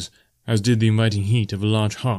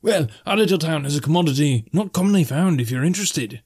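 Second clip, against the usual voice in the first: high, lilting salesman-innkeeper; high-voiced barkeep with wares to sell; barkeep making an offer; 7 semitones higher than usual. A narrator's reading. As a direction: tempt the guest, pitched well up.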